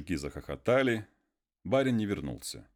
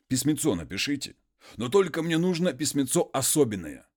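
lightly raised, eager peasant asking a favour; a hint of a humble muzhik pleading; 6 semitones higher, 4 dB louder than usual. Konstantin asks the master for a letter, lightly raised peasant voice.

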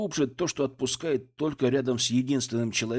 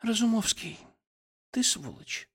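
whispering into chest; 9 semitones higher, 4 dB quieter than usual. Acted. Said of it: "прошептала она мне в грудь".

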